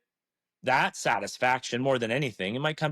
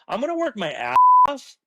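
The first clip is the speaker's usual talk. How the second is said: much higher and louder, punching out the vow; emphatic vow; 13 semitones higher, 10 dB louder than usual. Pretend. Pledging to work hard for his own business, loud emphatic vow. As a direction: declare it like a rallying cry.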